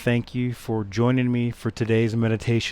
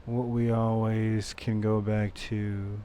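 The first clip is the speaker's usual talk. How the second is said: soft, unhurried meditation voice with long pauses; guided meditation; 4 semitones lower, 13 dB quieter than usual. Acted. Meditation teacher leading listeners back to the breath, soft guided-meditation voice. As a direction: guide them gently, much softer than a welcome. Leads a meditation after intro.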